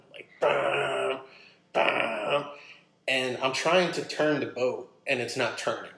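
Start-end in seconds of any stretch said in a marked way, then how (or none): 0.4-2.4 long blaring horn blast, voiced by mouth; imitated ship horn. Storyteller mimics a container ship bearing down, blaring horn noise. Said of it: "starts blasting its horn".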